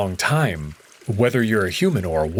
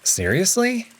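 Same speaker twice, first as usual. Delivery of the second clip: sharply rising disbelief; can't believe what he just said; incredulous; 9 semitones higher than usual. A narrator's reading. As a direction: skeptical question, pitch leaping up. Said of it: "Now I really give Amicus a look".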